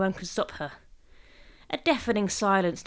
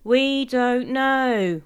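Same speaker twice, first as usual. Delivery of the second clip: very slow, loud, high singsong; dutiful chorus; 5 semitones higher, 7 dB louder, much slower than usual. A narrator's reading. Family humouring William's joke, chorusing dutifully. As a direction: drag it out like a dutiful audience. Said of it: "we dutifully chorused".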